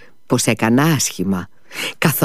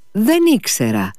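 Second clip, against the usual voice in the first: mock-courteous apology, pitched higher; politely needling, in a raised pitch; ironic politeness; 8 semitones higher than usual. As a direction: apologise with exaggerated, insincere courtesy. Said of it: "πήρε εξάφνου το πιο ευγενικό ύφος".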